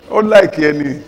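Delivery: ordinary delivery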